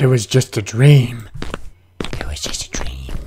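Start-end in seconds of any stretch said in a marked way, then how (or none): 2.0-3.1 hushed whisper to himself; whispered self-comfort. Pretend. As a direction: breathe it as a secret. Reassuring himself in horror game.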